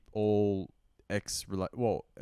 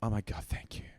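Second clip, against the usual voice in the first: imitated awestruck whisper; whispered gratitude; 7 dB quieter than usual. Co-host imitating the thanks he never had, whispered gratitude. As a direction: whisper it like an overwhelmed lover. Imagines a grateful woman.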